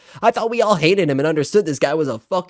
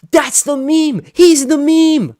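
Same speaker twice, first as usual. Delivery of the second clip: ordinary talk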